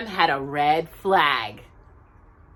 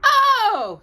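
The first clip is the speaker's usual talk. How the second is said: high, loud gasp of delight; surprised oh; 14 semitones higher, 6 dB louder than usual. Acted. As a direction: cry out in surprise. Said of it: "said Tootle".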